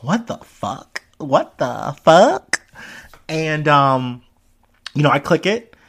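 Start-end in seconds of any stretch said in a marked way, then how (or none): 0.0-1.0 stunned disbelief. Retold or imitated. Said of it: "And I'm like". 1.2-2.6 drawn-out incredulous question rising on FUCK. Retold or imitated. Repeating the reaction to the clip, louder rising disbelief. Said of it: "And I'm like".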